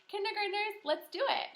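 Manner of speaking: high, loud cheer to the class; rally the little ones; teacher cheering kindergartners on; excited call-out. Real, not acted. Teacher calls out to kids.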